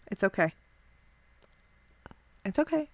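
calming himself after the decision; quiet self-reassurance. Acted. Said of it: "nodding his head a little bit".

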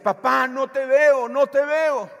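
loud, high desperate cry for papa; girl screaming. Retold or imitated. Trapped girl calling her father, retold loud cry. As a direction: scream for your father through the smoke. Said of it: "la niña gritaba".

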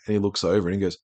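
ordinary delivery

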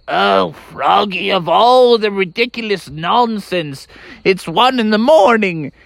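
high, drawn-out scolding mother voice; exasperated mom. Acted. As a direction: scold slowly with big swooping pitch. Annoyed mom woken at night.

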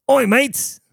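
high-pitched would-be Irish-or-Australian call; mock Australian accent. Joker trying an Irish goodbye, shaky Irish-Australian accent. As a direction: hail them in a badly faked Australian accent. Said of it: "Or is that Australian?".

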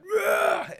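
loud, high imitated roar; mock roar. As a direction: let out a sudden mock battle yell.

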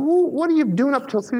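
high, disbelieving question; incredulous, high. Retold or imitated. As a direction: sound shocked they stayed up. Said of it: "I said".

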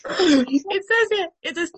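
high, slow, squeaky with laughter; real laughing fit. Real, not acted. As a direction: try to resume reading through giggles.

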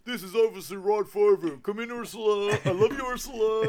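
high, loud, hammed-up cop voice; bad Father impersonation. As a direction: do a terrible impression of a lovesick police officer. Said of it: "doing a really bad father impersonation".

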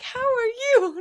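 high bright fake-nice how are you; mock cheerful customer; overly friendly greeting. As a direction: greet like nothing happened. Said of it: "when they were like".

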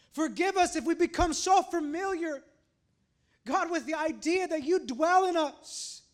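high, loud plea to God; impassioned prayer. Real, not acted. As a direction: cry out in prayer, stretching the short pleas. Prays aloud for forgiveness.

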